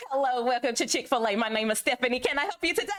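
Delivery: high, chirpy customer-service greeting; put-on perky fast-food server voice; Chick-fil-A greeter. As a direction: greet a customer in a sing-song, high-pitched voice. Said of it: "you're always gonna get".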